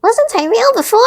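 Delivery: high, loud, wondering toy voice; the little rabbit asking. A narrator's reading. Toy rabbit asking the fairy, high and loud. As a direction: ask in wide-eyed wonder. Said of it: "asked the little rabbit".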